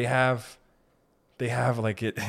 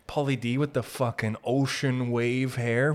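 ordinary delivery

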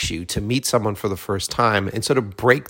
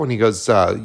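ordinary talk, nothing marked

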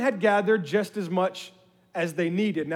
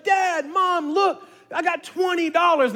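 put-on high, excited child's voice; excited little girl; 8 semitones higher, slower than usual. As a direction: squeal it like a kid finding treasure. Daughter excited, voiced in story.

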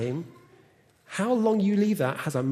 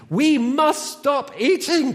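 higher, louder mock alarm; alarmed cry; 9 semitones higher, 7 dB louder, much slower than usual. A narrator's reading. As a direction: cry out as if it must end now. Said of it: "cried Toad".

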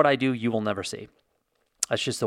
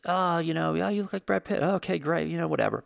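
higher-pitched, casual imitation of passing comments; mock offhand chatter; 6 semitones higher, 3 dB quieter than usual. Strangers remark on his Brad Pitt looks, mock offhand. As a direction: toss it off lightly, high and dismissive.

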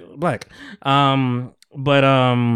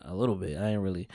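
sheepish mumble; 7 semitones lower, 13 dB quieter than usual. Retold or imitated. Sheepish admission to nurse.

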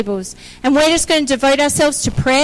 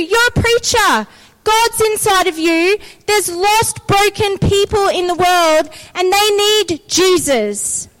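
higher, insistent preacher's delivery; emphatic preaching; 4 semitones higher than usual. Real, not acted. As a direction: preach it to the congregation, driving each phrase home. Preaches with force to congregation.

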